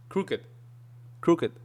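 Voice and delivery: "Crooked" ending in a light, quick T; subtle final T. Shown as a demonstration. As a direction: end "Crooked" with the tongue barely touching the palate. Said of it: "See, it's very subtle".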